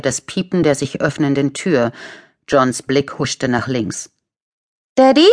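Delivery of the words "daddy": put-on high, loud questioning child's call; high-pitched child asking for father; little boy. A narrator's reading. Small boy calls his father.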